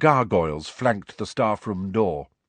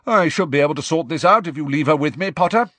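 high, loud, fast practical voice; brisk professor; 7 semitones higher, 6 dB louder, faster than usual. A narrator's reading. Professor offering to treat pupil's owl, briskly. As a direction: rattle it off briskly and loudly.

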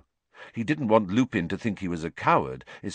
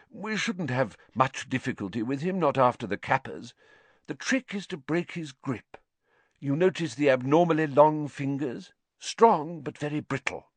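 higher, musing teacher's explanation; thoughtful; 7 semitones higher than usual. A narrator's reading. Teacher surveying the water demon, thoughtful. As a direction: muse aloud while studying a specimen. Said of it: "surveying the Grindylow thoughtfully".

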